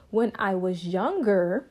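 usual speaking voice

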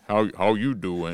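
lightly voiced character, feeling for the voice; attempted substitute voice. Teller retrying the officer's greeting, tentative put-on voice. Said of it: "that, that's not right".

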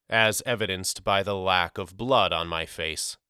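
ordinary delivery

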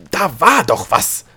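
loud, high, drawn-out outburst; sudden outburst. A narrator's reading. Gnome no longer able to hold back, bursting out loudly. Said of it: "brach es plötzlich aus ihm hervor".

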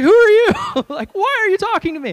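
mock screeching rebuff, high and very loud; indignant. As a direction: yell as if affronted by a stranger. Retold girl rebuffs the stranger.

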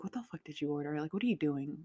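fast under-the-breath grumble; muttering to herself. Retold or imitated. Looking at her Amazon boxes, quick soft mutter. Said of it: "I'm like".

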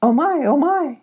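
high, alarmed exclamation; startled goat. Acted. Goat startling Eric and Sylvie awake, high and alarmed. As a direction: cry out in fluttery alarm. Startled goats find the sleepers.

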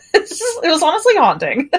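high, fast, through laughter; laughing. Real, not acted. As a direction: laugh while saying it.

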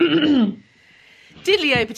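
slow, silly stage-Irish catchphrase; terrible Irish accent. Pretend. Host teasing a stuck co-host, silly mock Irish accent. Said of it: "my terrible Irish accent starts now".

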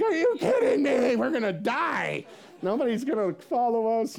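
high, loud mock panic; freaking out. Pastor answering his eager co-pastor, hammed-up panic. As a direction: wail it like comic doom. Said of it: "I was freaking out".

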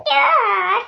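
a high, squeaky toy-llama "Ja". Pretend. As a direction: keep the playful toy voice. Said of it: "Lama Tschüss".

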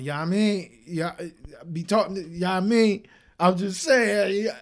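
exaggerated New York mumble; mock New York accent. Imitating [name]'s New York accent to mock it.

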